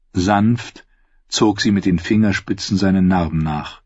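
usual speaking voice

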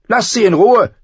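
high, loud angry shout; furious young girl. A narrator's reading. Arya defending the wolf, shouting angrily. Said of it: "rief Arya wütend".

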